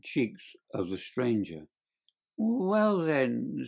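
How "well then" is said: reluctant. A narrator's reading. Little man caught out, gives in.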